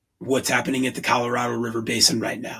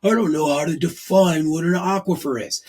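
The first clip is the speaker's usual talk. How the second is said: higher, louder mock-confused voice; confused layperson; 6 semitones higher, 4 dB louder than usual. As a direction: mimic a baffled person who doesn't get aquifers. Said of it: "people out there right now going".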